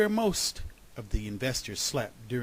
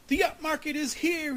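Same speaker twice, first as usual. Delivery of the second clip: high-pitched, louder proclamation; excited shout; 5 dB louder than usual. A narrator's reading. Groundhog spreading news through the woods, excited shout. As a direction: cry out the great news. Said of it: "he shouted".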